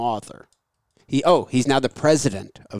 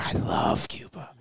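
tourist gushing; 8 semitones lower, 6 dB quieter, slower than usual. Retold or imitated. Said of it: "they start to go".

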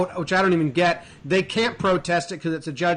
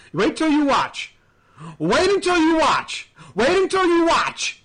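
higher and louder with each repeat; angry manager yelling at the ump; mock angry yell; 8 semitones higher, 4 dB louder than usual. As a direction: shout it like a threat, building. Voicing Martinez threatening ump.

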